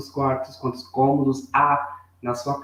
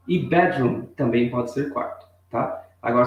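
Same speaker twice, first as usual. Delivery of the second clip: ordinary talk, nothing marked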